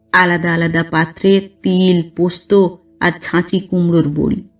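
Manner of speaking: plain reading voice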